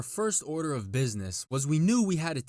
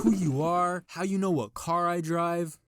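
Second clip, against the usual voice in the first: retold shouting; 6 semitones higher, 4 dB louder than usual. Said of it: "He starts shouting back".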